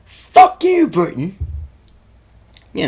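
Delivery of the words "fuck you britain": sudden high, loud joking shout; mock yell. American teasing his British mate on Freedom Day, loud mock yell. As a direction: bellow it like a rowdy patriot. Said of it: "the usual yelling of".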